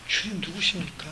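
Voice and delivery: a softened, humble question; humble hushed question. Retold or imitated. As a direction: ask meekly, almost bowing. Saul humbly asks who speaks.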